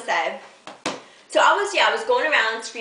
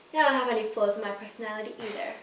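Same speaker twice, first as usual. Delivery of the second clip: usual speaking voice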